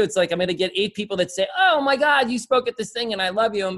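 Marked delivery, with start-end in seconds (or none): 1.6-3.7 high mock gushing fan voice; a hammed-up fan fawning over him. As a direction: gush like an adoring fan.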